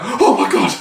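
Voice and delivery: high-pitched, hammed-up Shakespearean wail; full-on theatrical crying. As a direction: overdo a tearful, high-pitched protest like a stage tragedy. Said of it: "full on Shakespeare on it".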